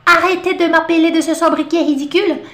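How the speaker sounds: a touch of an indignant character; quoted book line. Retold or imitated. Teacher repeating the book character's complaint, lightly indignant. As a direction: lightly act the annoyed adult. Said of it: "uma frase clássica é".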